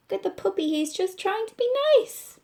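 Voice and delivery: reassuring Pete calming Melvin; gentle reassurance. Acted. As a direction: soothe a worried friend.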